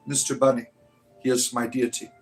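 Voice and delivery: ordinary talk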